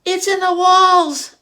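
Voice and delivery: loud high screech, slow. Acted. Owl screeching about the noise in the walls. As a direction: screech it out in alarm. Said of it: "Owl screeched".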